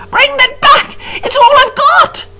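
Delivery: high, loud outraged yell; shout. A narrator's reading. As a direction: bellow at the fleeing rat. Prisoner shouting after rat.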